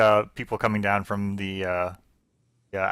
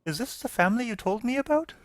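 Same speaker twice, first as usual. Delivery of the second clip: very high, soft character voice; Dorothy; 12 semitones higher, 6 dB quieter than usual. Acted. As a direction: ask timidly in a small, high voice. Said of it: "Dorothy's like".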